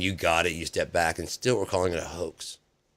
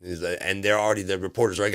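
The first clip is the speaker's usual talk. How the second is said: ordinary delivery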